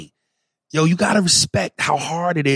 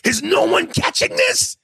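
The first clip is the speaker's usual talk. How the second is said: incredulous shout. Retold or imitated. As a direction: yell it in disbelief. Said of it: "I was like".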